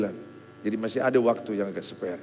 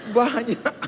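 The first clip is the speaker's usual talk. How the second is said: much higher, loud gleeful relief; mock glee; 12 semitones higher, 5 dB louder, slower than usual. Preacher mocking relief that friends failed too, high and gleeful. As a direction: ham up the joy of shared misery.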